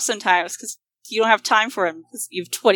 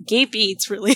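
usual speaking voice

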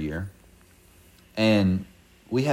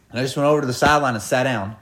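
usual speaking voice

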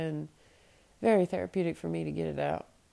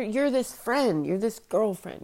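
ordinary talk

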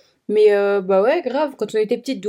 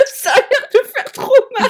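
aiguë, étranglée de rire; après un fou rire; 13 semitones higher, 5 dB louder than usual. Real, not acted. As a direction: parle entre deux éclats de rire. Speaking through laughter. (voix haute pleine de rire)

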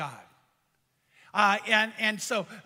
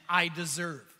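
lower, "I deserve" as a sulky claim; entitled inner voice; 5 semitones lower than usual. Retold or imitated. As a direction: voice the selfish thought, low. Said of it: "tempt you with entitlement".

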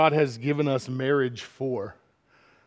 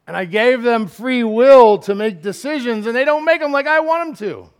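high, loud exasperation climbing higher; exasperated parent; 8 semitones higher, 9 dB louder than usual. Acted. Parent whose children ignore free will's purpose, exasperated. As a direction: let the pitch climb as you complain. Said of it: "Isn't that what God's saying?".

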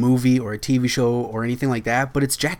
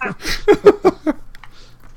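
high laugh; 4 dB louder than usual. Real, not acted. Review joke lands.

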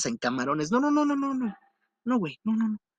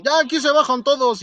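combi driver; 7 semitones higher than usual. Retold or imitated. Driver telling passengers to get off, calling out. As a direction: call out the last stop to everyone. Driver announcing last stop.

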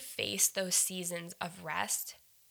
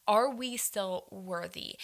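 usual speaking voice

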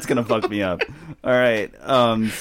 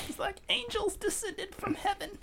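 high, soft laughing praise; laughing gush; 15 dB quieter than usual. Real, not acted. Gushing over a beautiful performance.

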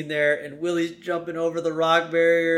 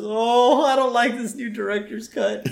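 high, dismayed cartoon whine; whining complaint; 9 semitones higher than usual. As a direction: moan like a let-down moviegoer. Homer whining at the changed film.

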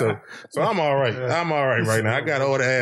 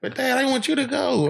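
put-on much higher, girlish plea; pleading daughter; 12 semitones higher than usual. Daughter asking dad to the school ball, imitated high-pitched plea. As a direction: imitate a child begging dad to come along.

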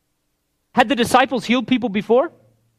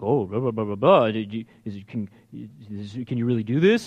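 mock the scribes with a deep sneer; pompous lower scoffing Pharisee; 11 semitones lower, 7 dB quieter than usual. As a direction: voice dropped low, sneering questions. Mocking religious leaders questioning.

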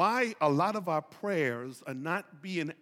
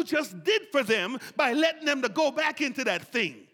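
loud, higher, urgent plea to God; Moses pleading; 7 semitones higher, 4 dB louder than usual. Retold or imitated. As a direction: beg God to stop, full voice. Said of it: "It's like Moses is saying to God".

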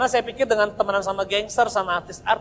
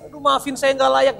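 high, hammed-up pleading apology; mock melancholy; 8 semitones higher, 5 dB louder than usual. Son parodying his own apology to his father, mock melancholy. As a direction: whine it as a self-parody.

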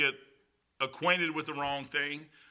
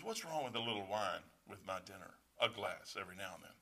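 much softer and lower, calm and unbothered; quiet, lightly voiced calm friend; calm Tom; 9 semitones lower, 14 dB quieter than usual. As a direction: mimic Tom's calm shrug of a question. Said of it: "he'd be all calm".

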